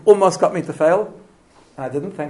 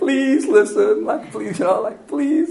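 higher, helpless begging; pleading; 5 semitones higher, much slower than usual. Pretend. Parent pleading with a kid who ignores them, high and helpless. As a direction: beg the kid weakly, giving up. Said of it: "you're pleading with the kid".

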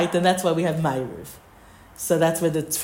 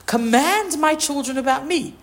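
raised pitch, emphatic acted reply; insistent counter-demand; 8 semitones higher than usual. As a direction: turn the demand back on the listener. Acted reply of husband to wife.

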